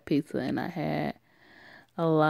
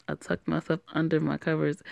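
usual speaking voice

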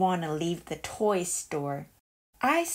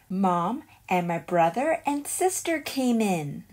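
ordinary delivery, nothing marked

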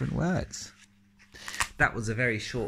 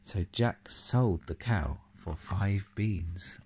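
ordinary delivery

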